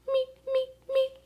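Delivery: tiny high, soft crying meeps; a little lost alien whimpering. Acted. The Martian crying to the puppies, tiny high meeps. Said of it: "let out a tiny meep".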